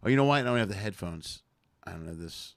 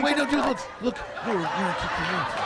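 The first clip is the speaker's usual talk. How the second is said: high, loud, giggling mock panic; frantic laughing explanation; laughing plea; 9 semitones higher, 5 dB louder than usual. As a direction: squeal it like the prankster backing off.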